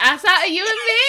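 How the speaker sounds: high, fast, crying with laughter; laughing hysterically. Real, not acted. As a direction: laugh till you cry. Both collapsing in laughter.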